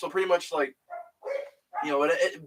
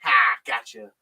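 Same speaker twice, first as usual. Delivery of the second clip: teasing gotcha; 7 semitones lower, 3 dB louder than usual. Retold or imitated. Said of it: "she's like".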